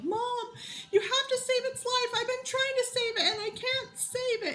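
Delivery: high, pleading little-girl voice, mock bawling; imitating crying daughter. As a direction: play the sobbing child begging mom to save the mouse. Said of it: "Jersey comes in my room bawling".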